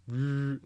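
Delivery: rolling r like a train; train rumble. Retold or imitated. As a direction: growl like an engine. Imitating a train engine.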